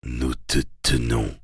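low, hushed inner thought; quiet resolve. Acted. Hypnotist silently addresses the hunted werewolf, quietly resolved.

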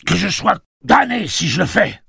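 high, loud outburst; defiant shout. A narrator's reading. Gâcheur defying Tic-Tac over the scarf, shouted. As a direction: yell your refusal in outrage. Gâcheur defies the chief's order.

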